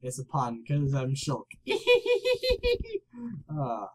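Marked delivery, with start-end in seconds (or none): 1.7-3.4 very high, squeaky giggle; tee-hee high up at your own joke. Real, not acted. Player laughing at his own pun, high giggle.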